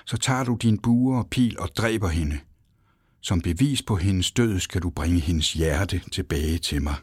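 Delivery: ordinary delivery, nothing marked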